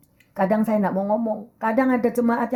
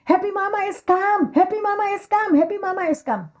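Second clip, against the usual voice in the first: high sing-song greeting; lively sing-song shop greeting; salon staff calling out; 9 semitones higher than usual. Retold or imitated. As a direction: call it out cheerfully like shop staff welcoming a regular. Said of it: "saya selalu dipanggil".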